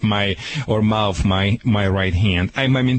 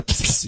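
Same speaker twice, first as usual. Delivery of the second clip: spray hiss. Shown as a demonstration. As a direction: imitate a burst of compressed air. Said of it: "air comes out".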